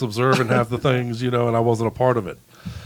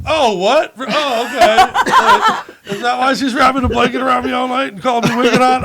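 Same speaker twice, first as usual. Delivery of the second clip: stunned dawning realization; 9 semitones higher, 7 dB louder than usual. Retold or imitated. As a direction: act the clueless guy suddenly getting it, laughing. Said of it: "And I was like".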